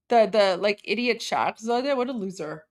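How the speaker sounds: ordinary talk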